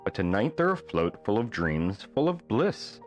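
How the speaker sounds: ordinary delivery, nothing marked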